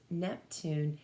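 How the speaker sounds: usual speaking voice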